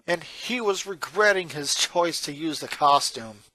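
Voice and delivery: ordinary delivery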